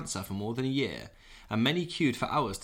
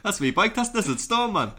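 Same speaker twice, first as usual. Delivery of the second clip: put-on Geordie accent; 9 semitones higher, 6 dB louder than usual. Continuing Geordie bit.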